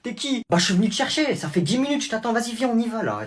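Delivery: put-on high, loud taunting kid voice; cocky kid bully. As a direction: swagger like a tiny tough guy. Small kid bullying big kid.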